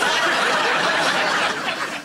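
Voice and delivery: high breathy laugh; burst of laughter. Real, not acted. Telling the funeral story, bursts out laughing. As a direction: laugh out loud at the memory.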